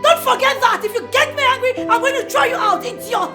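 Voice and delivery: high, heated telling-off; angry scolding. Acted. Acted quarrel, scolding Gina in her house.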